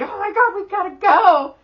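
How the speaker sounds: high excited urging; high-pitched eager shout. Retold or imitated. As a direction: hurry everyone up, thrilled. Excited exclamation to family.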